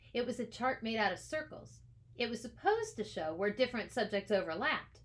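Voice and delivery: ordinary delivery, nothing marked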